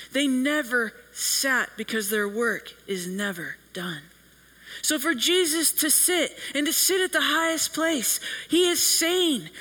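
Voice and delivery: ordinary talk, nothing marked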